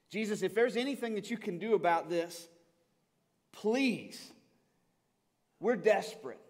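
begging at the top of the voice, high and loud; desperate plea. Acted. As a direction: cry out like a father who has nothing left. Father begging Jesus for his son.

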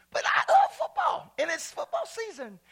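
high, loud squeal of enthusiasm; excited about football. Real, not acted. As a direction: burst out like a thrilled fan. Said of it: "I get excited about football".

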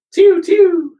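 high, loud "pew" of a ray gun; imitated laser shot. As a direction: mimic a beam weapon firing. Imitating the beam weapon firing.

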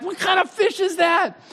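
hammed-up disbelief at a small fish; scoffing fisherman. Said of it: "You're like".

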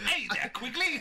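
put-on high, loud, laughing British accent; mock British accent. Joining co-host's joke about the actor, mock British accent. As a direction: play up a silly British greeting. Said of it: "him talking in a British accent".

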